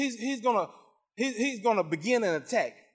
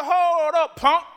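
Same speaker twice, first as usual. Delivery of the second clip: high, loud challenge to the devil; retold rebuke; 7 semitones higher, 5 dB louder, much slower than usual. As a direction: call out a drawn-out, defiant halt. Tells off the devil in story.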